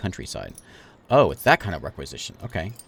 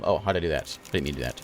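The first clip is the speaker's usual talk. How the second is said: ordinary delivery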